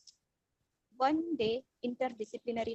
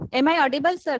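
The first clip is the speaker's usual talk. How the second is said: much louder, checking she is heard; 12 dB louder, faster than usual. Real, not acted. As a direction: speak up into the mic.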